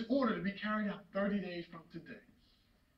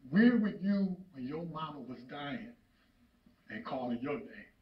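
low question sinking softer at the end; grieving reproach; 9 semitones lower, 7 dB quieter than usual. Acted. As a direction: let the blame fall away into grief. Grieving father blames son.

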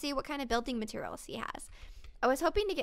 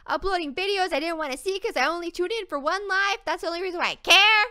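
whiny viewer impression; 3 semitones higher, 7 dB louder than usual. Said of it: "You're just probably like".

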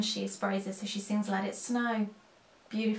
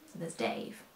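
low aside; 11 semitones lower, 8 dB quieter than usual. Real, not acted. Introducing the duster by name.

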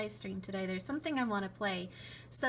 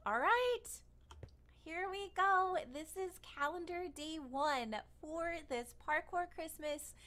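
stream intro; 5 semitones higher, 4 dB quieter than usual. Acted.

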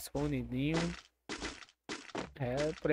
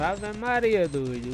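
excited; 5 semitones higher, 5 dB louder, slower than usual. Real, not acted. Sudden knockdown in match.

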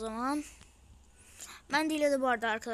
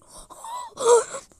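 loud high gasp of shock; excited gasp; 10 semitones higher, 12 dB louder than usual. Real, not acted. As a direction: gasp in disbelief. Shock at the pull. (heyecanla nefesi kesilerek)

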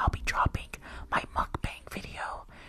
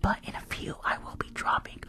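ordinary talk, nothing marked

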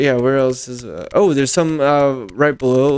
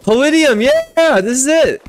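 high, excited burst of joy; thrilled; 11 semitones higher, 4 dB louder, slower than usual. Real, not acted. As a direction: let the excitement spill over. Thrilled at finding palladium.

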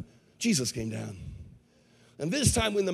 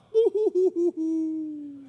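long, high hammed-up wail; exaggerated fake boo-hoo sob; mock crying; 11 semitones higher than usual. Man crying on seeing Jesus, mock wailing. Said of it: "he started to cry".

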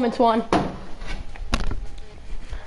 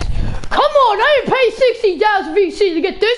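frustrated outburst; 7 semitones higher than usual. Real, not acted. Frustrated at a bad pack.

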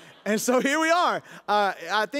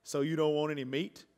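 lower, soft smart-aleck cashier voice; mock cashier; 5 semitones lower, 5 dB quieter than usual. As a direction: play the drive-thru joker deadpan. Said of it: "And he said".

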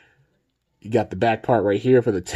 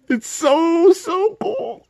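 voice squeaking; much slower than usual. Real, not acted. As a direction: voice cracks up high with excitement. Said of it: "my voice just, like, squeaked".

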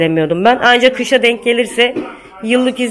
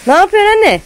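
high-pitched call to mother-in-law; 9 semitones higher, 3 dB louder, faster than usual. Real, not acted. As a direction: call over to her, pitch jumping up. Calls out to mother-in-law outside. (yüksek perdeden seslenerek)

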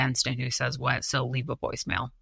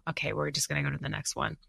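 ordinary delivery

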